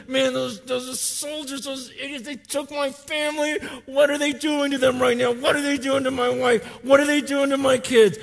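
high, desperate questions; anguished David. Retold or imitated. David fearing for his wife and kids, anguished. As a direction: plead what are they doing.

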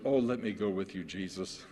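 softly imitated plea, trailing low; lightly voiced freed man begging to follow; pleading demoniac. Freed demoniac begging Jesus to take him along, softly pleading. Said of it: "can't you hear the tone in his voice".

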